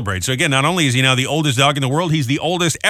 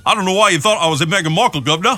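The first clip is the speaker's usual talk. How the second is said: put-on King Charles accent; 4 semitones higher, faster than usual. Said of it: "I have a harder time doing that than the Cockney accent".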